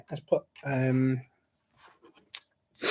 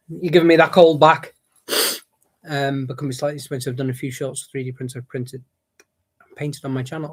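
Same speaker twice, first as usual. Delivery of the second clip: ordinary talk, nothing marked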